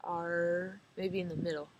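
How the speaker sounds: usual speaking voice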